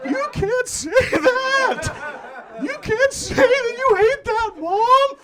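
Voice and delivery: high slow laughing disbelief; shocked protest. Retold or imitated. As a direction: squeal it out, scandalized at mom. Shocked at mom's remark.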